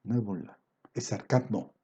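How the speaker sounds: hushed second take; quiet repeat. Real, not acted. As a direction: drop the voice almost to an aside. Preacher repeats the phrase, then drops his voice.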